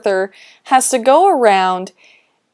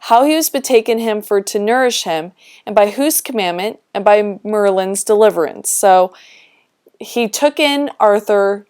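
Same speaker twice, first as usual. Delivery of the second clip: ordinary delivery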